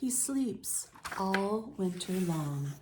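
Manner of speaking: slow, gentle read-aloud; story narration. A narrator's reading. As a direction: read it calmly to young listeners. Reads picture book to children.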